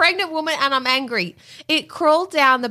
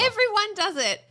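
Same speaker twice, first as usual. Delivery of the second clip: high, sceptical echo of his words; mocking echo; 10 semitones higher, 4 dB quieter than usual. Real, not acted. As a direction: throw his words back at him. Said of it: "mocking repeat".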